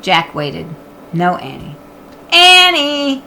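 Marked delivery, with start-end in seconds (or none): 2.3-3.2 high, loud call across the woods; shouted name. Acted. Jack calling his sister in the woods, loud shout. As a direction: yell her name. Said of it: "he shouted again".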